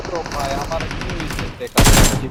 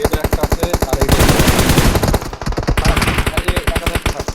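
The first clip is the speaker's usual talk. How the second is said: loud callout; 9 dB louder than usual. Real, not acted. Calling enemy positions out loud.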